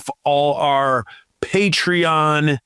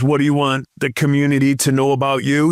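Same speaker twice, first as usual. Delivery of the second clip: ordinary delivery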